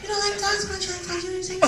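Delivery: high, soft pestering lady; coworker voice. Retold or imitated. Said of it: "but I had time that day".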